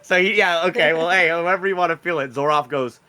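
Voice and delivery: normal talking voice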